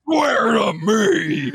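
slow, loud shouted Batman rasp; Batman growl. Retold or imitated.

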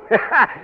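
short bright laugh; amused laugh. Acted. King laughing at meeting his double, short laugh. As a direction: laugh at the joke of seeing double.

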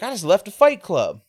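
high, loud, exhilarated boast; pumped up. Retold or imitated. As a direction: imitate a guy buzzing from a fight. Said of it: "He's all pumped up".

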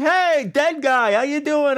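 high, slow drawn-out mock greeting; imitating cast teasing. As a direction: stretch the hey way up like a teasing coworker. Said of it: "he's like".

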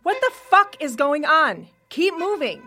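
alarmed shout. Acted. Tessa confronts John and Sarah, alarmed shout. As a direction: yell it out in alarm.

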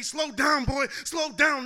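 loud, slow shouted command; yelling slow down, drawn out; mock yell. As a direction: bellow it like the crowd yelling. Crowd yelling at the poet.

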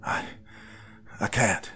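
tearful, halting reply; trembling. A narrator's reading. Young man admitting he can't go on, trembling. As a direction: voice trembling between pauses. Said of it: "His voice trembled".

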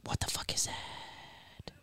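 hushed conspiratorial whisper. Real, not acted. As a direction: breathe it like a secret. Conspiratorial aside about drones.

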